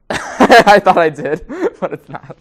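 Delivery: laughing through the words. Real, not acted. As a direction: laugh while admitting it.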